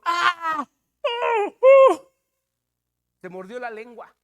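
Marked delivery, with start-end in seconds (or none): 0.0-2.1 high, loud yelping scream; pained scream. Retold or imitated. Preacher acts out the woman biting her tongue, pained scream. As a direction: cry out loudly, pitch leaping up. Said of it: "una de ellas gritó".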